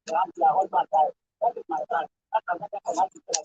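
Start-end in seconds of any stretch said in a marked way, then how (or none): none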